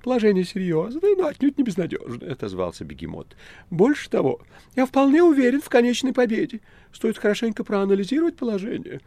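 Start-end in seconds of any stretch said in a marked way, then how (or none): 0.0-2.3 very high, loud cat voice; self-important talking cat, high-pitched; cat Behemoth. Acted. Behemoth speaks. 3.7-9.0 very high, loud cat voice; pompous talking cat, high-pitched; confident cat Behemoth. Acted. Behemoth boasts of victory.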